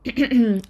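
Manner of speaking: nervous throat clear. A narrator's reading. James nervously clears his throat before confessing to Remus. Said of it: "então pigarreou".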